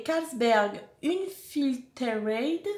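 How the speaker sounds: slow, laboured English with a French accent; attempted English accent. Real, not acted. Reading a beer name to viewers, clumsy attempted English accent. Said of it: "Ah, mon accent".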